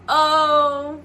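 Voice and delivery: loud, amazed oh; surprised oh. Retold or imitated. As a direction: react to having landed. Realizing she's in America.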